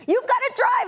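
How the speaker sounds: high, urgent panicked husband; alarmed husband. Retold or imitated. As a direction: blurt it out in alarm. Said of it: "He's like".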